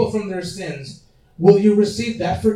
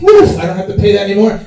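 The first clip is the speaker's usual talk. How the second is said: relieved; 8 dB louder, much faster than usual. Acted. Relieved debtor in acted story.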